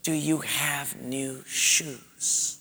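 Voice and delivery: very slow, soft whisper. Acted. Teacher testing a pupil's hearing, slow soft whisper. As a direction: whisper it slowly, word by word. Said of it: "Sort of like she'd whisper".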